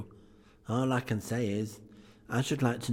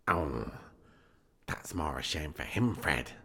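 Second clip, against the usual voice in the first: lower-pitched, understated indignation; mildly indignant niece; 5 semitones lower than usual. Acted. Niece reproving Fred about Scrooge, mild indignation. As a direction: put on a mildly indignant lady. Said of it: "said Scrooge's niece indignantly".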